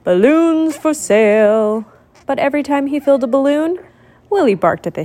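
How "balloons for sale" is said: slow, drawn-out seller's call. Acted. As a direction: stretch the call across the parade. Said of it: "and then he called".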